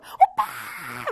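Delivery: long, high, cracked scream; hammed-up, drawn-out fan shriek; screaming fangirls. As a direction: scream out of the window at a crush. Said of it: "막 소리 져요".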